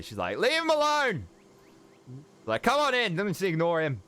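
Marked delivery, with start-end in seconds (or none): 0.4-1.3 high-pitched angry yell, acted. Kenna yelling from the foyer at the guards, high and angry. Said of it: "Kenna yell angrily from the foyer". 2.6-4.0 high, exasperated call; Kenna. Acted. Kenna calling the party in past the guards, high exasperated yell. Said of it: "Kenna yell angrily from the foyer".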